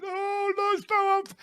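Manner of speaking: high, whiny fake sobbing; mock crying. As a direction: wail it like a fake crybaby. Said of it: "Taylor started crying and doing the crying emoji".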